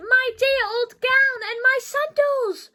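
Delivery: high, loud happy shout; joyful yell. Acted. George spots his old gown in the bag and yells with joy. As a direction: yell with delight at finding it. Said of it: "he yelled".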